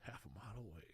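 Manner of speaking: put-on southern twang; mock southern old-timer accent. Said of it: "like, a southern twang".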